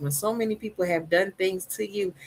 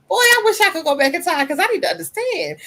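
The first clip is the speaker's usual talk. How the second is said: high, loud longing cry; wistful outburst; 10 semitones higher, 6 dB louder than usual. Real, not acted. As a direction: wish you could go back, said aloud. Wistful outburst about past self.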